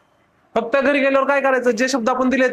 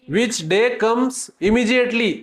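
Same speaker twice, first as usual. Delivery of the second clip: slow reading aloud; much slower than usual. Shown as a demonstration. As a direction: read it very slowly for the class. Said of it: "Immediately म्हणजे लगेच".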